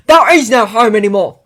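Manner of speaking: loud bitter shout; angry shouting. Acted. Ron shouting in quarrel.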